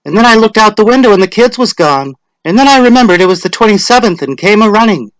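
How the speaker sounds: high, loud chatty wife; Missus Hutchinson. A narrator's reading. Latecomer explains to her neighbour, high chatty voice. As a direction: rattle it off like a flustered housewife. Tessie explains being late.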